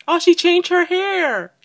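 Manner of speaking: high, loud excited exclamation; loud high-pitched fangirl reaction; excited outburst. Real, not acted. Excited reaction to game update.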